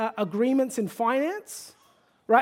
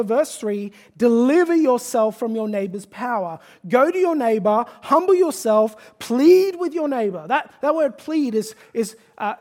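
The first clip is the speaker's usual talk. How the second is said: louder, urgent pulpit exhortation; preaching at full force; 5 dB louder than usual. Acted. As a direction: drive the command home to the congregation. Pleads with congregation.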